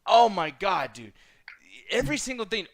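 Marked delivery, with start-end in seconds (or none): none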